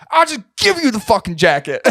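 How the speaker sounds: high-pitched mock exasperated yell; hammed-up homeless man losing patience; fed-up outburst. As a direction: blurt it out like a desperate seller giving up. Seller's fed-up outburst, retold.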